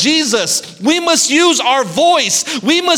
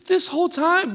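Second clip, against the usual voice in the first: ordinary talk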